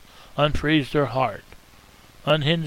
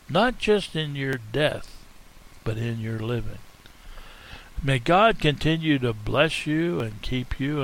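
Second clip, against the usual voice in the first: normal talking voice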